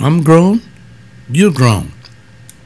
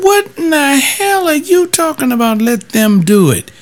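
high, indignant challenge; retold outrage; 14 semitones higher than usual. Pastor replaying his furious retort to James Frost, high and indignant. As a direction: throw it back at the deacon board chairman, high and hot. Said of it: "I said".

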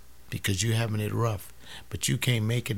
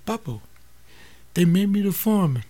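proud son; 7 semitones higher, faster than usual. Acted. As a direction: burst out with the good news. Son announcing promotion.

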